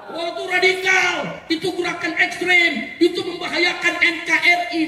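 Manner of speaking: high-pitched imitation of accusers; mock alarm. Speaker mimicking those who call the rally radical, mock alarm. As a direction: parody their overblown warning.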